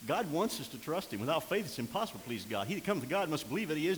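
normal talking voice

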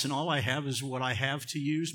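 normal talking voice

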